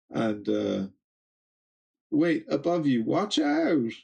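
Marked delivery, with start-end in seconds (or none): 2.1-3.9 higher, urgent call; warning cry. Acted. Kids warning the parents of danger above, high urgent call. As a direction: shout a warning upward.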